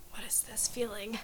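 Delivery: unvoiced, breathless swooning whisper; dreamy whisper. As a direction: sigh it out, smitten.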